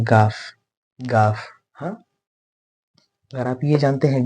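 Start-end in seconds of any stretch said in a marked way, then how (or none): 0.0-1.5 gaaf as Urdu speakers say it. Shown as a demonstration. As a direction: say गाफ like a plain hard g sound. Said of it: "Urdu वाले ये जानते होंगे".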